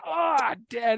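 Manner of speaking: playful startled protest to Dad; dazzled kid protesting. Acted. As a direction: act out a child caught in the glare. Kid blinded by dad's camera, acted.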